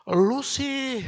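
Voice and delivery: sharp, high, loud "your fault" jab; mock blame. Preacher mocking people who blame a fallen man, loud scolding jab. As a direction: scold the one who fell, loudly. Blaming someone who just fell.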